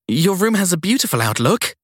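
flustered. Acted. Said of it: "wishing she sounded less flustered".

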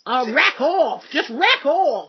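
loud mock Aussie yell; hammed-up Australian telling off; Australian soap accent. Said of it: "Australian soaps then".

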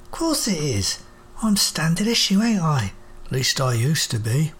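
put-on cheerful old friend, a little rueful; cockney robot Wiggins.

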